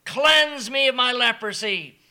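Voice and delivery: loud earnest plea; loud believing cry; pleading leper. Acted.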